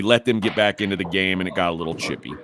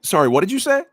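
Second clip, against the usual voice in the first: high, louder challenging question; retold incredulous challenge, pitched up; confronting challenge; 10 semitones higher, 5 dB louder, faster than usual. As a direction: call out a rival as if you misheard. Retells confronting the coach.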